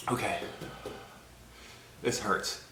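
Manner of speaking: ordinary talk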